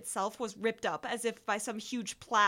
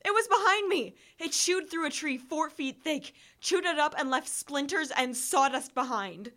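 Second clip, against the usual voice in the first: high, loud panic; terrified shout; 6 semitones higher, 6 dB louder than usual. A narrator's reading. Narrator fleeing the tree-chewing monster, terrified shout. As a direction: yell it as the thing closes in. Monster right behind him.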